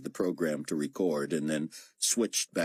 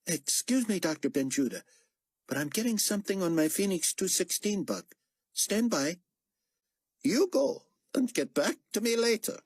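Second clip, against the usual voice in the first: raised, lightly voiced character line; 14 semitones higher than usual. A narrator's reading. Chang proposing a trick to the team, lightly voiced. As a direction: pitch it up for the character.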